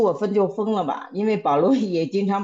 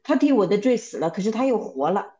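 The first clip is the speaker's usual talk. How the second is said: ordinary talk, nothing marked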